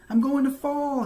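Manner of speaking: high, loud frightened cry; scared. A narrator's reading. Franklin to his mother as the bike wobbles, frightened high cry. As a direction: panic as the bike tips. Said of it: "he said".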